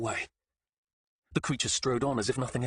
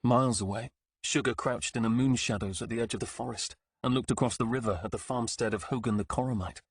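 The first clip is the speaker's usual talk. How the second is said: ordinary delivery, nothing marked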